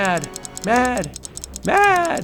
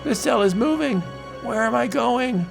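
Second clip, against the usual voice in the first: alarmed cry; 11 semitones higher than usual. Acted. Cell hurled away.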